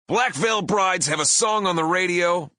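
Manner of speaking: high, loud heel boast; pro wrestler bellow. Retold or imitated. Singer boasting at the booing crowd, wrestler bellow. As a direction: brag at the hecklers like a wrestler. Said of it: "bellowed in my best professional wrestler voice".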